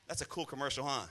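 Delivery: ordinary talk, nothing marked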